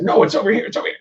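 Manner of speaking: high, urgent little brain-cell voice; panicky neurons. Acted. Neurons calling out where the lyrics are, in mock panic. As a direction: pipe up like a frantic searcher, pitched high. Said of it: "But the neurons will say".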